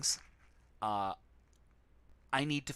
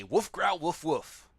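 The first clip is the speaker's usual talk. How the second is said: higher, louder mock bark and growl; guard dog barking; 4 semitones higher, 4 dB louder, slower than usual. Player voicing the dog leading the way, louder mock barking. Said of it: "that's the dog and Picasso both talking".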